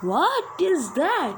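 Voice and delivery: slow, high, swooping question; real disbelief. Real, not acted. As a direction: draw it out high in startled disbelief. Startled reaction to horror video.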